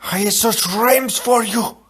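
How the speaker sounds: dying lament. Acted. Inigo lamenting near death.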